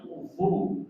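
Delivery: uzu with lips rounded and pushed out; teacher modelling uzu; lips pushed forward. Shown as a demonstration. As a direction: round the mouth fully and push the lips out. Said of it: "mulutnya sempurna, monyongnya".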